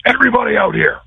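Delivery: high carrying call, retold; coach calling out. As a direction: summon the team to midfield. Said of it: "and he said".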